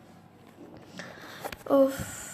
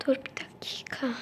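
whisper; 8 dB quieter than usual. Acted. Sneaks in, hushed.

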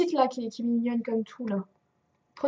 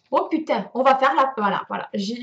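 ordinary delivery

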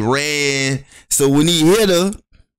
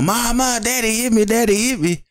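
put-on high whiny girl voice; a child tattling to mama; whining girl; 9 semitones higher than usual. Girl running to her mother, mock whining.

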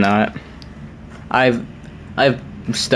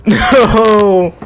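higher, louder desperate calling out; distressed shout; 9 semitones higher, 5 dB louder, much slower than usual. Real, not acted. Player shouting at whoever takes his new friend, distressed. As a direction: plead loudly for the bear back. Bear carried off in game.

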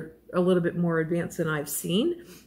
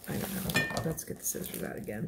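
much softer, quick whisper; trying to be quiet; 9 dB quieter, faster than usual. Real, not acted. Crafter whispering to keep quiet while cutting yarn. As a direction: hush it so the rustle stays down. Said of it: "Trying to be quiet".

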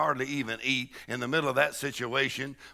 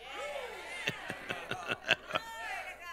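joyful whoop; 11 dB quieter than usual. Real, not acted. Preacher shouting for joy.

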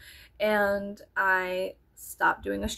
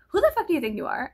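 mock fast snap; 5 semitones higher, 5 dB louder, faster than usual. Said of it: "to being like".

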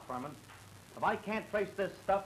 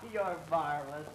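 normal talking voice

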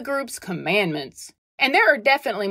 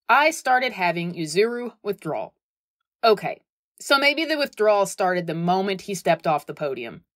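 ordinary delivery

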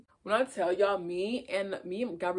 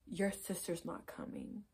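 soft, slow and flat-out blunt; cold quiet announcement; 11 dB quieter, slower than usual. Retold or imitated. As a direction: drop the news quietly and coldly. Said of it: "turns around and looks at me and says".

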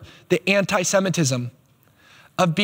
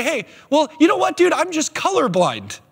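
mocking people who claim colorblindness, much higher; 10 semitones higher than usual. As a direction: parody a breezy dismissive guy. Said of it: "A lot of other people would say".

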